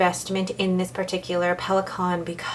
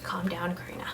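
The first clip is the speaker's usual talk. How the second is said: softer, whispered calm down Karina; whispered self-talk; 10 dB quieter, faster than usual. Real, not acted. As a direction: hush yourself. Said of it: "It's like".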